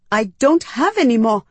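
high, loud, alarmed protest; panicking. A narrator's reading. Human refusing the Medus's demand, panicking. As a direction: let panic lift the voice. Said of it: "I said, panicking".

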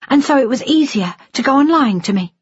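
high-pitched angry accusation; stung, accusing daughter; lashing out. A narrator's reading. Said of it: "Jennifer lashed out".

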